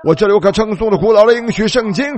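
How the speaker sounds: usual speaking voice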